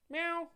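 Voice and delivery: high, soft imitated meow. Meowing to call his cat Mia, high and soft.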